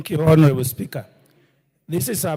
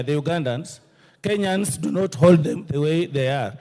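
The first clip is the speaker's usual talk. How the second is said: ordinary delivery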